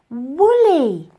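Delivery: loud, bright sudden idea; exclaimed. A narrator's reading. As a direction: burst out with the name. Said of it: "exclaimed the girl".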